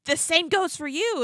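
high, slow comeback in a retold quarrel; mock angry retort. Retelling Jackie's answer to Teresa, mock angry retort. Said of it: "people just started screaming at each other".

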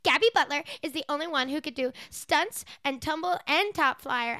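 put-on high, loud little girl gushing. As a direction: gush like a bratty kid. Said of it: "the little girls are like".

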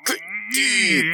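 high, slow, hammed-up wail; mock wail. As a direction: wail it like a joke lament. Hammed-up echo of the joke.

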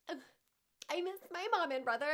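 high, fast, loud weepy whine; whiny teary self-quote. Retold or imitated.

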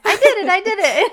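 put-on girl doing her happy dance, squealing with glee; happy dance cheer. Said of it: "This is my happy dance".

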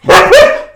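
a loud shrieking laugh; loud high laugh. Real, not acted. Laughing so loud the audio peaks.

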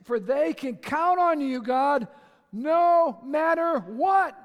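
slow, loud, building to a shout; emphatic preaching. Acted. Preacher urging the congregation, slow, loud and rising. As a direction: stretch out "no matter what" and shout it. Preacher drives home the verse.

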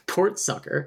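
laughing while swapping the sounds of "port sucker"; spoonerism through a laugh. Shown as a demonstration. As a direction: giggle out "port sucker" as a silly slip. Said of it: "sport pucker".

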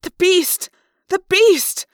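high, loud panicked shout; terrified cry. Acted. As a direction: cry out in terror at the monster.

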